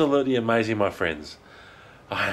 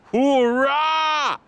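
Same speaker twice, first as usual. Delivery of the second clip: "Hoorah!" much higher and loud, falling; high excited cheer; 13 semitones higher, 4 dB louder than usual. Real, not acted. [name] celebrating for viewers, high cheer. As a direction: cheer again, soaring up in joy.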